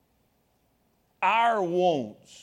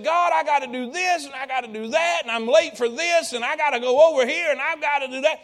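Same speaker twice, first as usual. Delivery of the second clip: frustrated, loud, high complaining; imitating a loud, frustrated whine; whining to God; 6 semitones higher, 4 dB louder than usual. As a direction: vent your busy list at God in a whine. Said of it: "kinda whining to God".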